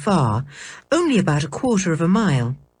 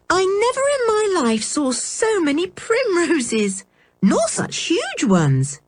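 put-on delighted girl; 9 semitones higher than usual. A narrator's reading. Anne looking down at the primroses, high delight. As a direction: gasp with wonder at the flowers.

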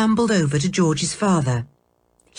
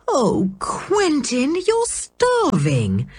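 slow, higher, worried; distressed wife; 8 semitones higher, much slower than usual. A narrator's reading. Aunt Fanny frets over her thin husband, slow and worried. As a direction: fuss over him, slowly and anxiously. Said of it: "watched him in distress".

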